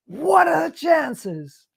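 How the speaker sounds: slow, high mocking repeat. Teller mocking the clueless man to Grace, slow mock exclaim. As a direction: ridicule the man's wonder. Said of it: "this clown actually thought".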